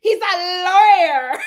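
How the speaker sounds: slow, loud laughing cry; laughing shout. Retold or imitated. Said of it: "And Alexia was like".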